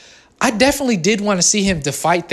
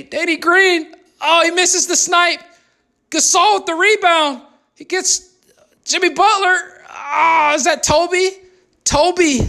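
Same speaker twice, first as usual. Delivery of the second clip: much higher, urgent commentator; hyped commentator calling the rebound, much higher; excited call; 9 semitones higher than usual. Acted. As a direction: ride the big moment like a hyped announcer. Commentary climax of the play.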